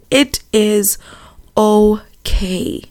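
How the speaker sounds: slow, word-by-word comfort fading into a whisper; tender, spaced-out retelling of gran; deliberate reassurance. Retold or imitated. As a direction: let each word land, whispering the last. Repeats gran's comfort slowly for emphasis.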